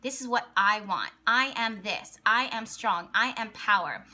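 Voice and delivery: ordinary talk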